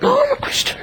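high mimicking of the earnest friend; imitating mate. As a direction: parrot the mate in a silly high voice.

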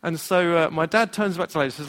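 ordinary talk, nothing marked

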